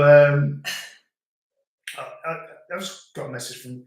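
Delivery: ordinary talk, nothing marked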